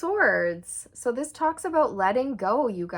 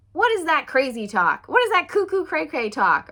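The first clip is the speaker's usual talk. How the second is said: louder, raised mock scoff; mocking skeptic; 7 semitones higher, 6 dB louder than usual. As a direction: scoff like a doubter.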